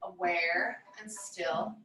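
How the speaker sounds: measured, soothing yoga nidra voice; guided meditation. Real, not acted. Yoga teacher settling listeners down for Yoga Nidra, guided meditation voice. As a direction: guide them into stillness, phrase by phrase with long pauses.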